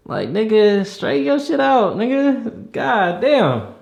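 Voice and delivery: high, slow, fed-up rebuke; exasperated scolding. Retold or imitated. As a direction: drag it out in disbelief. Exasperated reply to bent neck.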